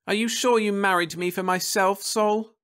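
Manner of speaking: put-on high, searching young woman; Florence's voice. A narrator's reading. Wife questioning her husband's motives, high and doubtful.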